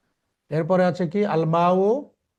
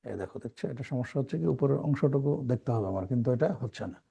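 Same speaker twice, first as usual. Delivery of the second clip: spoken off to the side, much softer and lower; softer aside about the screen display; quiet aside; 5 semitones lower, 7 dB quieter than usual. Real, not acted. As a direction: drop out of the lesson into a soft side remark.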